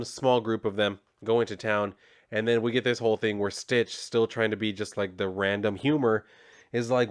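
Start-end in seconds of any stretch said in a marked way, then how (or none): none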